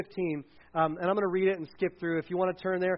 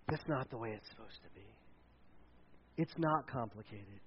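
low, hushed, measured reassurance; quiet correction; 8 semitones lower, 12 dB quieter than usual. Acted. Pastor voicing Jesus on piled-up rules, low and quiet. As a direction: drop down soft and low, unhurried.

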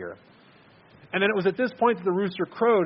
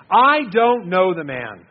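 very slow and much louder, swearing it; most forceful denial; 3 semitones higher, 9 dB louder, much slower than usual. Acted. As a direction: hammer out the final denial. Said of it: "Peter got a little more forceful in his denial each time".

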